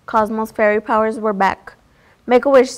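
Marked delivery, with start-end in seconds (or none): 2.3-2.8 louder call across the room. A narrator's reading. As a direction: call out urgently. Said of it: "Wanda called".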